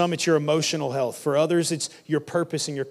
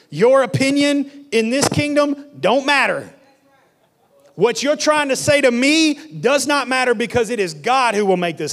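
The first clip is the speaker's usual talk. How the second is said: preaching shout; 9 semitones higher, 6 dB louder than usual. Acted. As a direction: thunder it at full force. Preaching climax, shouting the declaration.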